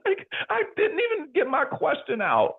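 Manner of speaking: high, laughing squeal. Real, not acted. Telling how a mentor cut him off, laughing. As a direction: squeeze it out through laughter.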